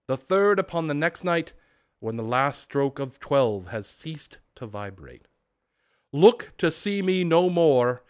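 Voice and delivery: loud solemn ghost; unearthly voice. Acted. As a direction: intone the prophecy like a spirit. Ghost's final warning.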